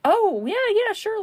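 high, loud, overeager yes; a put-on casual yes; mock eager agreement. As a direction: play it breezy and overeager. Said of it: "I'm like".

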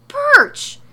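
very high, very loud shriek of BIRCH; full-blown incredulous scream; yelling. A narrator's reading. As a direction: explode on the word in outrage. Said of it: "Morrow yells".

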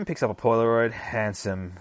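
normal talking voice

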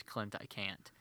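very soft reluctant quote; quiet. Retold or imitated. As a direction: say it barely audible. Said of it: "peeking around the corner and says".

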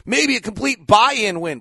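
ordinary delivery

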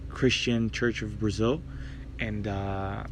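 usual speaking voice

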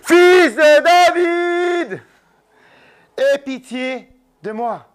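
very high, very slow, loud wailing call. Acted. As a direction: cry out even harder, stretching every word. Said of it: "il criait d'autant plus fort".

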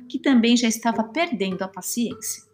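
ordinary delivery, nothing marked

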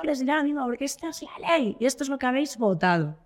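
high, preachy mock policeman; high mock sermon of a cop about the law; imitating the lecturing cop. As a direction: lecture her as the smug officer. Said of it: "el tío me gritaba".

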